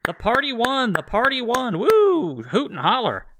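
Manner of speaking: high, whooping mock supporters; mock rally cheering. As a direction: whoop it up like a victory crowd. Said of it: "they go".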